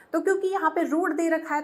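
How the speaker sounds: ordinary talk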